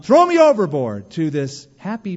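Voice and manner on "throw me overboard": loud, high cry, acted out; mock desperate shout. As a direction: shout it high like a man giving up, hammed-up. Acting Jonah's desperate earlier cry.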